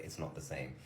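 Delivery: very quiet, low deadpan; barely voiced, dead-faced reply; deadpan, blunt. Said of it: "I just said it so bluntly".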